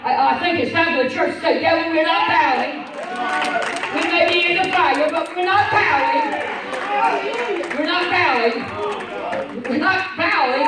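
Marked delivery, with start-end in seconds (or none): 1.6-10.7 loud, high preaching shout; rising preacher's declaration; defiant shout. Acted. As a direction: shout it down at the devil.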